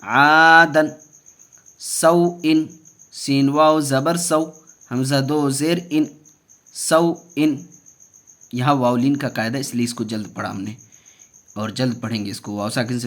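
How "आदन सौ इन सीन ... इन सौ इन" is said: measured, raised teaching chant; deliberate letter-by-letter drill; Qaida spelling recitation. Shown as a demonstration. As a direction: recite syllable by syllable for learners.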